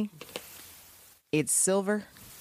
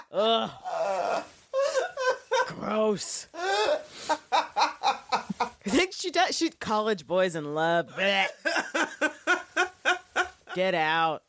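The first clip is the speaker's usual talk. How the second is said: disgusted laughing; 4 semitones higher, slower than usual. Retold or imitated. Mocking Maggie's disgust.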